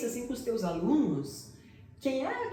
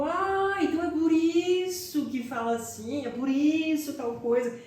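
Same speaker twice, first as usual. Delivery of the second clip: realizing; 6 semitones higher than usual. Retold or imitated. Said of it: "e fico".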